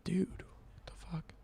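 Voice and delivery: hushed shocked whisper. Real, not acted. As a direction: whisper it so he won't hear. Reacting to roommate screaming.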